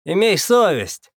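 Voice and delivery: loud, slow call of reproach; shouting out. Acted. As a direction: shout it over the crowd. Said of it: "корректно выкрикнул я".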